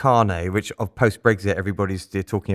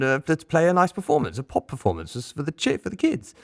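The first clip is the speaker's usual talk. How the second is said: innocent voice; 6 semitones higher than usual. Retold or imitated. Tim playing innocent to guards.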